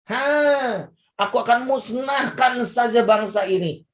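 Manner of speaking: God's fury at Israel, shouted high; angry God. Acted. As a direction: thunder it out as a furious God.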